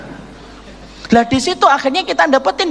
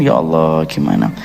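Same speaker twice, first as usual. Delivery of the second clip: much lower, murmured to himself; mock fretful sigh. Abdurrahman, unable to speak, frets privately in a low mock murmur. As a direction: mutter a worried plea under the breath.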